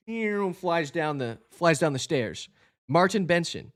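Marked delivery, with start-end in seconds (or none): none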